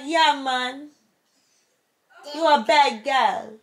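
put-on Jamaican accent. Imitating how they talk in Jamaica, mock Jamaican accent. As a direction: imitate islanders with a big Jamaican lilt. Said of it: "They be like".

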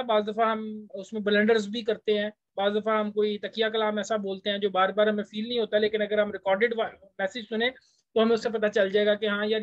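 ordinary talk

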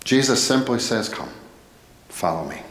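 usual speaking voice

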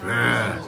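short low growly roar; little monster roar; mock roar. Fish roars at its prey.

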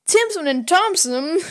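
high, loud call of the two names; excited shout. Retold or imitated. Tintin spots the two detectives, calls out in an excited shout. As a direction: shout out the names as you spot them. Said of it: "And he's like".

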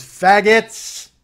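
put-on loud drunken yell out the window; yelled slur. Drunk passenger yelling at pedestrians from the car.

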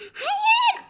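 high, loud desperate scream; a captive's shrill cry of no; shrieking no. Acted. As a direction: scream it out in terror. Captive cries out again.

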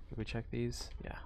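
soft unvoiced whisper. Real, not acted. Sneaking streamer whispering to chat. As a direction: ask it under your breath.

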